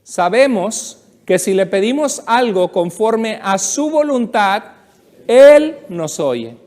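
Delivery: leer despacio, marcando cada palabra; leído en voz alta con la congregación, despacio; lectura pausada en voz alta. Shown as a demonstration. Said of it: "léalo conmigo". (lectura bíblica lenta y solemne)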